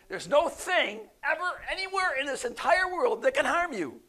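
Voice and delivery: loud, high, building insistence; emphatic preaching. Real, not acted. Preacher drives point home emphatically.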